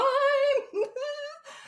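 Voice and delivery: a burst of high-pitched giggling; squeaky laugh; high giggle. Real, not acted. Giggling at Lohan memory.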